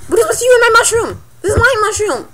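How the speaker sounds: high-pitched, hurried exasperation; mock indignant whine. Player asking what is with you and my mushroom, mock indignant whine. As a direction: protest it high, in put-on exasperation.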